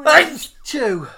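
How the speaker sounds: loud real sneeze; a sudden loud sneeze. Real, not acted. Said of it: "I had to sneeze".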